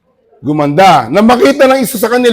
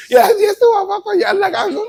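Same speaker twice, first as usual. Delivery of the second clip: mock excited cheer; 13 semitones higher than usual. Imitating joyful winner.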